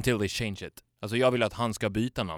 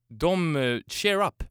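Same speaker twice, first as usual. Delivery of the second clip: normal talking voice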